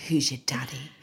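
breathy whispered voice note line; mock sultry whisper; whispered mock daddy. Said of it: "me sat at breakfast".

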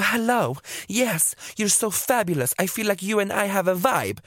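high, slow mocking voice of a fawning stranger; imitating the au pair. To two girls at a party, mock high fawning voice. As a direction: ham up the clingy girl, high and drawn out.

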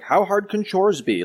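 put-on high, slow, cocky teen voice; mock smug daughter. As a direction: draw it out like an overconfident teenager. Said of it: "And the daughter's like".

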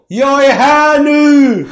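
very high, drawn-out yell; triumphant shout. Retold or imitated. Comedian announcing himself through the glass, long triumphant shout. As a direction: bellow a grand arrival, stretching the words. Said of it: "Sträcker ut händerna och skriker".

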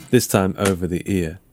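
ordinary delivery, nothing marked

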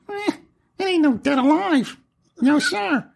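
very high, squeezed character voice; comic falsetto naysayer; silly put-on voice. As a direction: dismiss it in a goofy high-pitched voice. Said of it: "bring that voice back".

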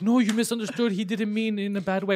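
the booth staff stepping in, mock placating; placating booth workers. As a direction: do the workers hurrying to calm him down. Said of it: "And then they're like".

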